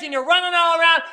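loud, high fervent preaching; full-force preaching. Acted. Preacher rousing the church, loud and high. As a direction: drive it home to the congregation.

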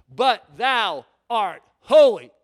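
slow, loud proclamation; emphatic scripture declaration. Acted. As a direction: declare it word by word, slowly and forcefully.

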